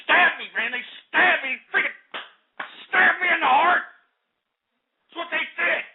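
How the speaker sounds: high, wounded outcry of betrayal; angry, emotional. Real, not acted. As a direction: cry out in angry hurt, voice climbing. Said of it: "I'm sorry if I'm getting a little angry".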